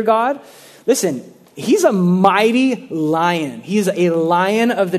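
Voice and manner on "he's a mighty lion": ordinary talk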